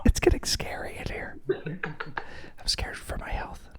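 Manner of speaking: playful fake-frightened whisper; mock scared whisper. Joking about tense co-host.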